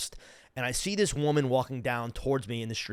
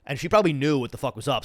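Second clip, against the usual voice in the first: ordinary talk, nothing marked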